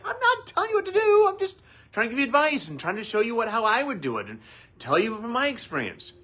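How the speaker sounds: mock husband protesting his good intentions; defensive husband. Husband defends his advice to wife, high and rushed. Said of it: "You're like".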